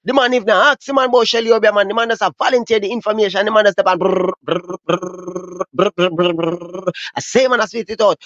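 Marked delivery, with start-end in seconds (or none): 4.0-6.7 gunfire brrr sounds. Retold or imitated. Imitating gunfire burst.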